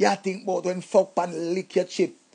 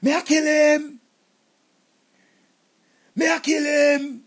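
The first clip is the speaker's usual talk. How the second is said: angry threat; 5 semitones higher, slower than usual. Acted. Jealous rage.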